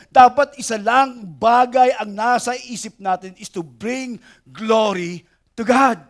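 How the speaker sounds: normal talking voice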